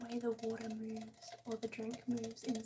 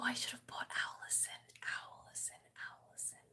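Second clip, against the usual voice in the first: fading whisper; 4 dB quieter than usual. Real, not acted. Trailing repeated name whisper.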